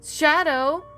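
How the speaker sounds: loud, high cry of alarm; alarmed exclamation. A narrator's reading. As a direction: call out to him in shock. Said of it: "Rouge exclaimed".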